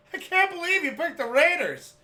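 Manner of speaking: high, loud incredulous cry; disbelieving yell. Retold or imitated. Dad amazed at the Raiders pick, high loud yelling.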